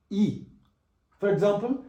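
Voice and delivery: ordinary delivery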